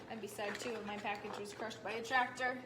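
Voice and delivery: ordinary delivery, nothing marked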